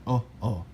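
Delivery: quiet, rounded "oh, oh"; short oh. Shown as a demonstration. Teacher demonstrating omicron's oh sound. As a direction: round the lips for "oh". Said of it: "makes a sound like oh".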